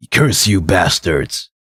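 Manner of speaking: slow, low monster growl; angry monster voice. Acted. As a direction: drag out a furious curse. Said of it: "He shouts angrily".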